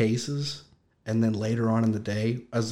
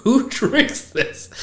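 disgusted; 3 dB louder, much slower than usual. Retold or imitated. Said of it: "I was like".

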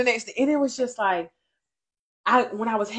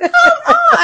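high, loud laughing "Come on"; 13 dB louder than usual. Real, not acted. Laughing at herself.

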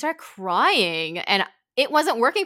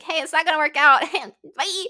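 breezy dismissal; 7 semitones higher, 7 dB louder than usual. Retold or imitated. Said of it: "I was just like".

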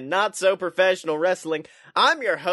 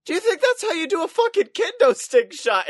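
mocking high voice; 9 semitones higher than usual. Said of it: "is like".

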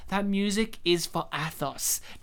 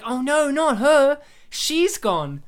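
mock lament; 6 semitones higher, 6 dB louder, slower than usual. Mocks the swelling movie music.

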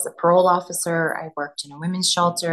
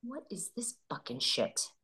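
mock grumble; 14 dB quieter than usual. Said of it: "they're like".

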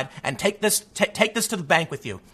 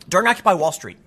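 ordinary talk

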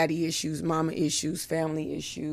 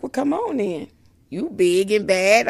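mother teasing; 3 semitones higher, 8 dB louder than usual. Retold or imitated. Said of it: "she's like".

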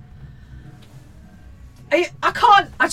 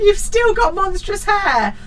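much higher, slow, louder taunt; mock child shout; 10 semitones higher, 5 dB louder, slower than usual. A narrator's reading. Children shouting at mum, mock loud. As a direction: call it out like a cheeky kid. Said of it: "shout at you from the top".

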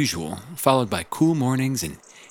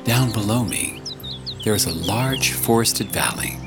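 audiobook narration. Acted. Reads audiobook chapter.

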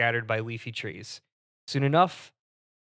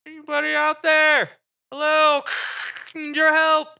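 high, faint voice from far off; dreamy distant voice; 5 dB louder than usual. Acted. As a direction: call out faintly through static.

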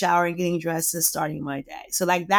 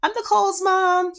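high, chirpy mock-mom greeting; pitched-up impression of an intruding mother; Teresa; 9 semitones higher than usual. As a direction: imitate mom greeting the call, then realizing she intruded. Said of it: "And then she's like".

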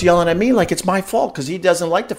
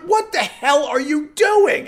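high, drawn-out outraged yell; retold angry shout; 10 semitones higher, slower than usual. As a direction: yell it in disbelief, stretching it out. Retelling his shouted confrontation.

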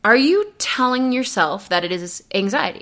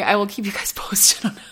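whisper. Real, not acted. Teasing aside about private news.

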